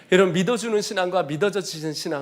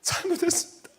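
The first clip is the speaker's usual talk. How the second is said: much higher, sobbing apology; crying repentance; 13 semitones higher than usual. Retold or imitated. Man beating the floor, crying to God for forgiveness. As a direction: keep breaking down on each sorry. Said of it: "제 발을 쳐면서".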